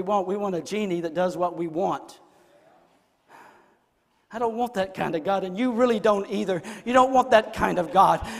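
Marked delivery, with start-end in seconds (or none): none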